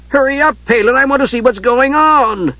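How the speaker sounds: Tass pestering Palin, high and eager; impatient kender. Acted. Tass hurrying Palin along, high, impatient kender voice.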